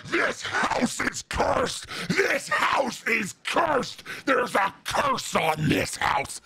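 slow, hoarse mock bellow of doom; super low voice. Woman screaming at the house from the street, super low voice. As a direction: drag out each word in a rough, screaming growl. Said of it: "super fucking low voice".

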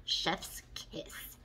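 whispered, soft approval. Real, not acted. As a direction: breathe "Chef's kiss" in a whisper. Admiring blended eyeshadow.

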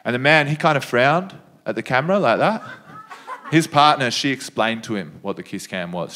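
usual speaking voice

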